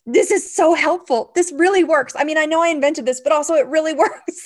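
high, loud rave with a laugh; excited gushing. Retold or imitated. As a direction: gush about your own invention. Retold excitement at own workshop.

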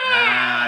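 high loud cackle. Acted. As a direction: shriek out a witch cackle. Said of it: "I didn't believe it for a second".

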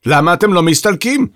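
irritated challenge; demanding. A narrator's reading. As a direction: tell them to clear off. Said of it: "דרש בטהובן".

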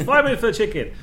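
mock indignant repeat. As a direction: throw the answer back in disbelief. Podcast host retells a scene.

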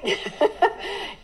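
short unvoiced laugh; little embarrassed laugh; breathy laugh. Real, not acted. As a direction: laugh it off sheepishly. Laughs at admission.

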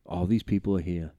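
Joe West. Retold or imitated. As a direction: lecture the umps low and smug. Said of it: "Joe West is like".

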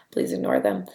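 whispering. Real, not acted. Aside about beeps.

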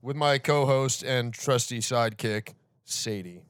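loud booming radio announcer; radio host voice. Pretend. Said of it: "give us your radio thing".

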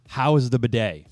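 usual speaking voice

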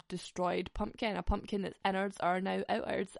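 ordinary delivery

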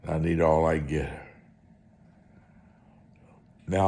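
ordinary talk

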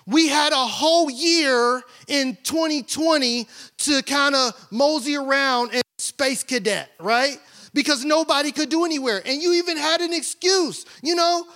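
preaching. Acted. Preaching at full force.

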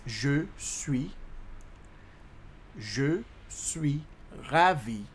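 slow, separated words for learners; teacherly dictation with pauses; slow breakdown. Shown as a demonstration. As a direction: spell out "je suis ravi" word by word. Teacher breaks French phrase down.

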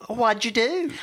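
high, loud Texan woman voice; texas accent. Acted. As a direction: play it as a Texas lady. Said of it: "put on your best Texas accent".

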